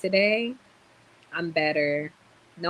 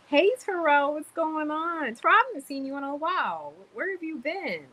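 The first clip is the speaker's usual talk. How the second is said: much higher, sing-song fake concern; mock sweet greeting; 10 semitones higher than usual. Calling to Terrell on the live, much higher teasing voice. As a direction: coo at Terrell like a sugary friend.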